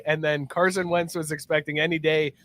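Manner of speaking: ordinary talk, nothing marked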